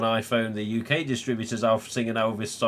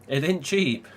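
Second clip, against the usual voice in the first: higher-pitched, breaking into a laugh; amused laughing; 8 semitones higher than usual. Real, not acted. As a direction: say it through rising laughter. Laughing at the steep bill.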